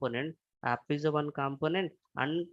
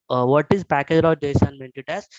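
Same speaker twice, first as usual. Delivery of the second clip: ordinary talk, nothing marked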